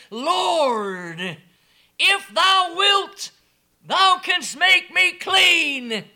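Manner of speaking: high, slow, loud plea; loud, slow, high begging cry; pleading leper. Acted. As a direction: beg slowly and loudly.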